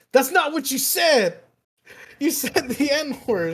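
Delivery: high-pitched mock scolding; indignant accusation. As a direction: call out the lie as an outraged dean. Dean rejects his excuse, retold.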